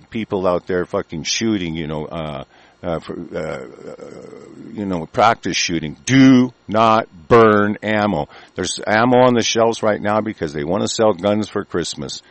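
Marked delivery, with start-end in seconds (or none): 6.1-8.3 every word spaced out and pressed down; slow and deliberate, hammer each word; slow emphatic warning. Real, not acted. Ranting host warns gun owners, slow emphatic warning. Warning listeners not to waste ammo.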